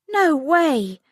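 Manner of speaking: high, loud shocked outburst; shocked recoil. A narrator's reading. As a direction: recoil in disbelief. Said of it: "Molly recoiled".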